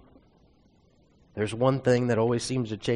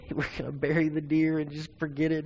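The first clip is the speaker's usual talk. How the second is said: higher, hammed-up mimicry of a son's tantrum; mocking imitation; 5 semitones higher than usual. Dad retelling the son's outburst to a friend, mocking. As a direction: mimic him to make fun of him. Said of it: "he's yelling and screaming".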